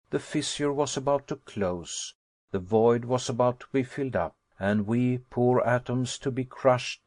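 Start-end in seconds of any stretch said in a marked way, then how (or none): none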